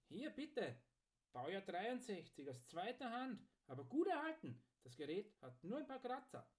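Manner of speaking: high-pitched, lilting sales patter; smith as salesman. Acted. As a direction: pitch the goods item by item.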